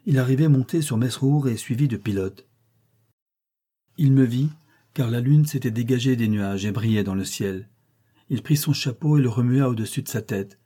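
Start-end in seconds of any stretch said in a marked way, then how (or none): none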